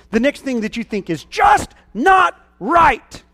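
high, loud, building sermon cry; preaching at full force. Acted.

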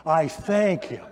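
repeats it through a real laugh. Real, not acted. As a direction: chuckle the words out, amused at himself.

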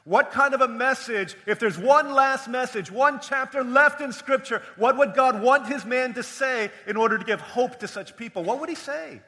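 high, loud pulpit questioning; ringing rhetorical build; impassioned preaching. Real, not acted. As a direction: press the question on the congregation, loudly.

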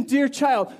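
usual speaking voice

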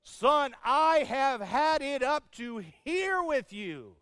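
loud, high, slow mock dad scolding; hammed-up father at the end of his rope; exasperated dad. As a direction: lay it on like a fed-up father. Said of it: "one of my favorite was".

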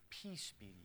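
soft, quiet benediction; hushed peace from John; gentle blessing. Retold or imitated. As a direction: say it like a tender blessing. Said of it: "John says this".

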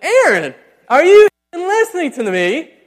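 a mom scolding her distracted son; exasperated mom. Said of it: "my mom says".

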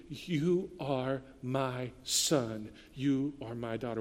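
tender assurance. Acted. God's voice answering grief.

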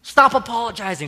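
high, very loud exasperated outburst; imitated yelling. Wife shutting down husband's apologies, imitated yelling. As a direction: yell it high, like a fed-up partner. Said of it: "She just kept yelling".

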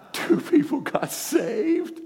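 high, drawn-out, laughing cry; excited exclamation. Real, not acted. Excited retelling of altar call.

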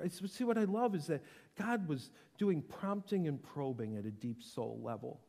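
usual speaking voice

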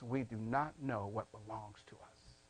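very soft, low and falling; hushed preaching. Real, not acted. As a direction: let the sobering point sink almost to a murmur. Sobering point, said low then repeated.

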